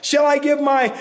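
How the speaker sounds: mock the careless people bargaining with God; flippant. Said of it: "in a very flippant manner".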